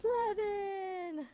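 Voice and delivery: excited. Acted. AI echoes the joke name.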